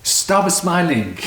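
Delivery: mock-exasperated command, stretched out slowly; retold agitation. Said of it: "but I was agitated".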